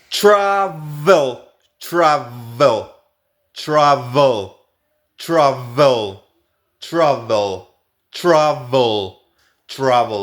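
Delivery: "travel" with a ch-like tr and buzzing v; ch-style tr. Shown as a demonstration. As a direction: say "travel" as "chravel". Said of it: "R es ch".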